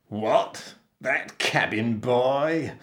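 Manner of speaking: slow, disbelieving, rising outburst; spluttering captain. A narrator's reading. Captain is asked to look after the boy, spluttering. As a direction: splutter it in indignation. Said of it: "spluttered the captain".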